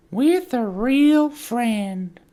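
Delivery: very high, loud, drawn-out silly voice. Acted. Repeats the line in put-on voices.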